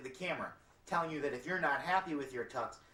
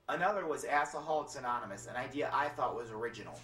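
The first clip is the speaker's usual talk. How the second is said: ordinary talk, nothing marked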